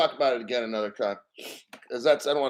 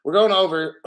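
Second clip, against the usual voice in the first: ordinary talk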